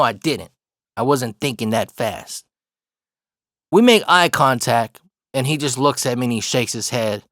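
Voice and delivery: ordinary talk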